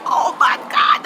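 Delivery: breathless, whispered oh my God; stunned gasp. Retold or imitated. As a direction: gasp it out in disbelief. Spots The Rock's plane.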